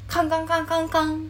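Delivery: high, quick kan-kan clanging; imitated clanging. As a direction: mimic feet ringing on metal stairs. Imitating footsteps clanging on stairs.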